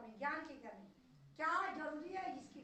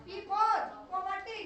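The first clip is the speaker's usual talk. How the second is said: "पी for poverty" said slowly, loud, each word hammered; slow emphatic teaching; 4 semitones higher, 11 dB louder, much slower than usual. Shown as a demonstration. Teacher hammering the mnemonic to students, slow and loud. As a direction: drill it into the class, word by word, loud. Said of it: "teacher spells out three P's".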